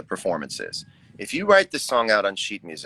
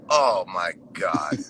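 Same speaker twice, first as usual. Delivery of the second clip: slow, lower "Oh my God"; staring in the mirror, slow lower mock dismay; 4 semitones lower, much slower than usual. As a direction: drag it out in lower mock horror. Self reaction in mirror.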